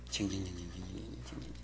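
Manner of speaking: low, very soft droning "jing-jing" whine; mimicked whining. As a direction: mumble a mock whine on repeated syllables. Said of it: "여자 징징거리는 거".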